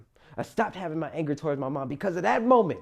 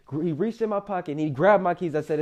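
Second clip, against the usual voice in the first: usual speaking voice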